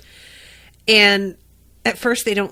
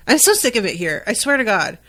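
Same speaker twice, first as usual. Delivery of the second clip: usual speaking voice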